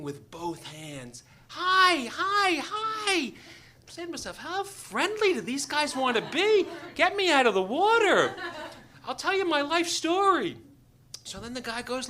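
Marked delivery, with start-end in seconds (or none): 1.5-3.3 high, loud repeated hails; excited high-pitched calls; frantic calling. Retold or imitated. Fishermen calling, waving both hands. 4.4-10.6 high, loud comic exasperation; worked-up, high-pitched complaint; exasperated. Retold or imitated. Exasperated swimmer's thoughts acted out.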